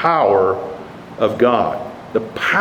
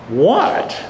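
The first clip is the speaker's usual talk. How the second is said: raised, rising note of disbelief; beside himself; 5 semitones higher than usual. Acted. Preacher voices Pharaoh answering the slaves, incredulous. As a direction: balk at an outrageous demand. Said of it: "Pharaoh is beside himself here".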